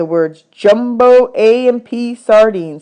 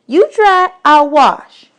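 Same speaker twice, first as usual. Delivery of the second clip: put-on much higher, bossy little girl; 8 semitones higher, 5 dB louder, much slower than usual. Desi quizzing new boys about dishes, high bossy girl voice. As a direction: boss the new boys around.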